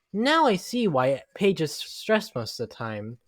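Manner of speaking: normal talking voice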